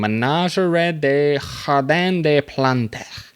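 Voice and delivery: Ménagerie des Jardins des Plantes read slowly in a mock Spanish accent. Reading a French name in a mock Spanish accent. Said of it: "I'm from Spain when I'm reading a French name".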